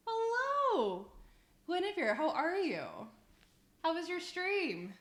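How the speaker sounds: high, drawn-out friendly hello; delighted greeting. Real, not acted. Streamer greets the visiting streamer Guinevere, delighted and high. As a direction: coo a happy welcome up high.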